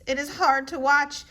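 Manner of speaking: ordinary delivery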